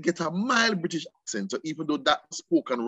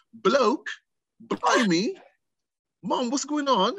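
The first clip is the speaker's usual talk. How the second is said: English accent; 9 semitones higher, slower than usual. Shown as a demonstration. Showing the host his old childhood voice, exaggerated English accent. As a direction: put on a proper English accent, pitched up. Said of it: "let me come here proper, proper".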